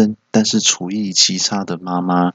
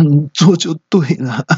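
crying voice; 8 semitones higher than usual. Retold or imitated. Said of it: "还带着哭腔说".

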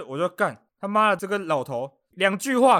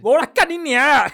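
much higher and louder mock yell; imitated cursing shout; 8 semitones higher, 9 dB louder than usual. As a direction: bellow the old man's curse. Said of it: "就一阵吼叫声". (模仿老头破口大骂)

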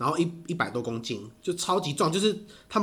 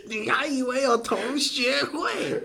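ordinary delivery

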